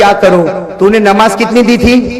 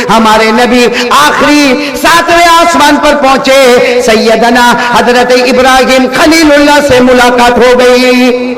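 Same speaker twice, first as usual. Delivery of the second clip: full-force preaching; 6 semitones higher, 4 dB louder than usual. Acted. Preacher recounts the Prophet's ascent to the crowd, full-force chant-like preaching. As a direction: preach it out in rising and settling waves.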